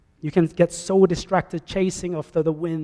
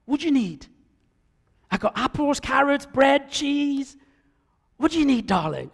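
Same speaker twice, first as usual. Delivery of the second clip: a lightly voiced market seller, higher and eager; market vendor; 8 semitones higher than usual. Vendor calling to a shopper at the Friday market, eager sales pitch. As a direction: hawk your goods to a passing shopper, a touch of chirpy trader. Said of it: "hawking wares with enthusiasm".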